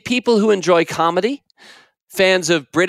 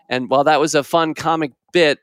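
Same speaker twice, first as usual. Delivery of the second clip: plain reading voice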